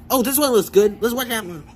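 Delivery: ordinary talk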